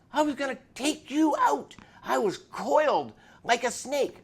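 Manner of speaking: high and loud, a wound-up biker confessing his violence; agitated biker voice. Retold or imitated. Biker voiced in retold story.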